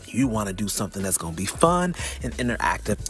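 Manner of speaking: ordinary talk, nothing marked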